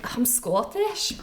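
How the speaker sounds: slow put-on Scottish accent. Shown as a demonstration. Guest answering the host's request in a slow mock Scottish accent. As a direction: drawl out a mock Scottish burr. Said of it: "Scottish accent?".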